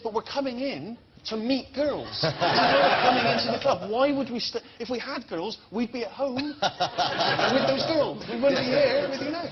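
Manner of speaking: high, laughing, outraged pleading; exasperated protest. Retold or imitated. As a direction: plead with the bouncer in disbelief. Retold argument with bouncer.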